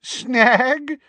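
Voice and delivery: high, loud, gleeful echo; vibrating with joy. A narrator's reading. Minister dismissing any problem, voice vibrating with joy. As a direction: throw the word back joyfully. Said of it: "his voice still vibrating with joy".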